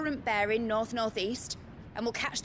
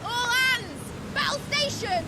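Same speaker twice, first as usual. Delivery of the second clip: high, loud call to battle stations; shouted alarm; 14 semitones higher, 4 dB louder, slower than usual. Acted. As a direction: rouse the whole ship at once. Captain calls crew to battle.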